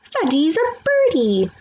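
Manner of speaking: put-on little boy flapping, proud of himself; toddler voice. A narrator's reading. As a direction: play a gleeful toddler pretending to fly. Toddler Fudge playing bird.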